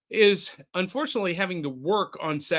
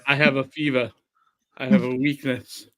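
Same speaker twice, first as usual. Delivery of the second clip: mock dramatic; 4 semitones lower than usual. Jokey confession about wrestling.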